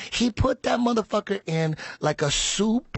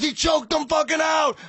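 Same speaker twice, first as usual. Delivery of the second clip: excited shout; 11 semitones higher than usual. Retold or imitated. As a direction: blurt out the finish. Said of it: "he goes".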